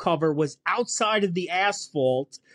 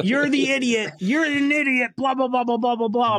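mock scolding; 6 semitones higher than usual. Mocking the boss's scolding.

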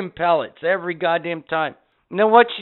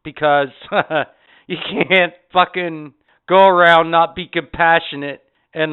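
ordinary delivery

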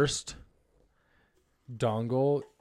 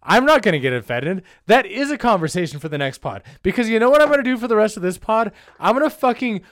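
loud, worked-up comic tirade; hammed-up indignant outburst; mock indignation; 5 semitones higher, 6 dB louder than usual. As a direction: rant loudly in put-on outrage. Mock indignant threat to co-host.

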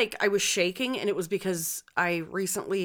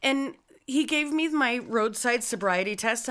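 usual speaking voice

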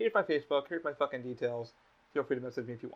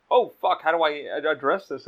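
imitated flustered reaction; 8 dB louder than usual. Host imitates his friend reacting to a blunt introduction, flustered mock alarm. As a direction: play someone thrown by an awkward introduction.

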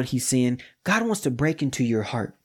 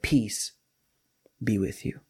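soft, gentle and quiet; gentle blessing; 4 semitones lower, 5 dB quieter, slower than usual. Retold or imitated. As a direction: speak peace softly over the room. Jesus's gentle greeting.

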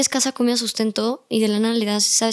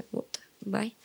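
muttered brush-off; 13 dB quieter, slower than usual. Retold or imitated. Young man brushed off rudely.

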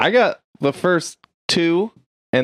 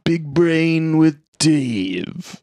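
slow, drawn-out title call; hammed-up show title; slower than usual. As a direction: stretch out each word of the title.

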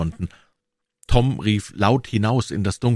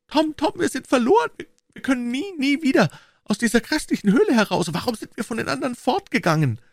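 put-on high, desperate girl's lament; high wailing despair. A narrator's reading. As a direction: near tears, all hope gone. Becky in despair.

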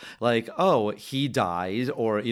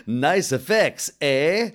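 louder and slow, drawn-out mock Canadian "Nice effects, eh?"; hammy Canadian sign reading; 3 semitones higher, 5 dB louder, slower than usual. Shown as a demonstration. Reading the movie's neon joke aloud, slow loud hammy Canadian. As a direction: read the sign like a goofy Canadian. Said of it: "neon flash that says".

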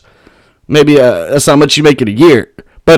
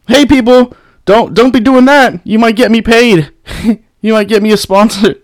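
usual speaking voice

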